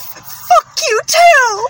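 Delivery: high, slow, wobbling sob; trembling crying voice. Retold or imitated. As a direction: quaver it out like about to cry. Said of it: "a trembling, crying voice".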